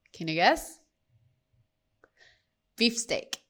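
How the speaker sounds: usual speaking voice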